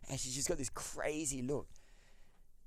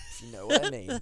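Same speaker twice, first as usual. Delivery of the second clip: much higher, cheeky wink in the voice; suggestive innuendo; 6 dB louder than usual. Real, not acted. Hinting to a friend about hostel showers, suggestive high innuendo. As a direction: make it sound naughty.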